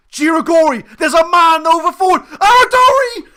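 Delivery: rising from loud calls to a full yell on Aradori!; building excited shout. Real, not acted. Manager commentating his own attack, building to an excited yell.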